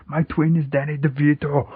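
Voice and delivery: hammed-up Austrian action hero; Arnold Schwarzenegger. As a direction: declare it like a muscleman star. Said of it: "random Arnold Schwarzenegger movies".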